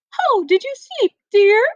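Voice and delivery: high, loud, sing-song royal queen. Acted. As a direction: ham up the nosy queen. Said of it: "the queen asked".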